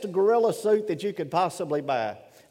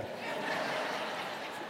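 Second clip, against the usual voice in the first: laughter; 14 dB quieter than usual. Real, not acted. Laughs at own joke.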